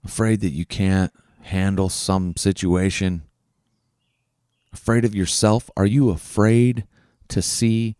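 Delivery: low, soft meditation voice; hushed, low-pitched breathing exercise; guided reflection. Real, not acted. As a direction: guide them gently inward, with long pauses. Leads a breathing and fear reflection.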